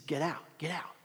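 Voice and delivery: quiet authority, little force; hushed command. Acted. Christ ordering darkness out, hushed command. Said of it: "he says".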